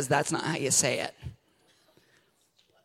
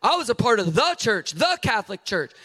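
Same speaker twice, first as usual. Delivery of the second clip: mock wrong answer; 8 semitones higher, 5 dB louder than usual. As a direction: pretend proud claim, loud. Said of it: "You better not say".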